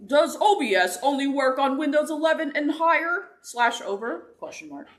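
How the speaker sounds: high, loud goofy meme voice; [name]'s meme voice. Acted. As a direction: read it like a silly internet meme. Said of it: "read one in, uh, [name]'s, like, meme voice".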